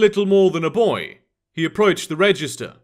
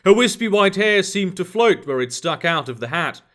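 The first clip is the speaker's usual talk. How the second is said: plain reading voice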